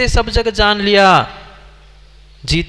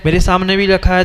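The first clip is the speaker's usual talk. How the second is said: ordinary talk, nothing marked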